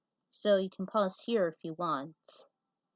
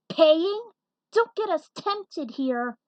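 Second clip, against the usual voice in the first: high, loud alarmed cry; a panicked plea to his partner; cried out; 8 semitones higher, 9 dB louder, slower than usual. A narrator's reading. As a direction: snap him out of the temptation. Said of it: "Alex cried".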